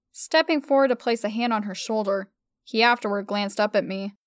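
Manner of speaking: usual speaking voice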